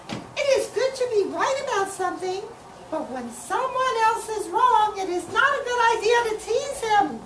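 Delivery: high, quick little grandmother voice; high grandmother voice giving a lesson. Acted. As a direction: advise him gently in a high squeaky voice.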